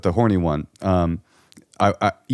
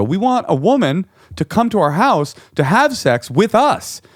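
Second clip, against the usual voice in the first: much higher, louder, eager plea; eager request; 11 semitones higher, 4 dB louder, slower than usual. Retold or imitated. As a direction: blurt out the wish excitedly.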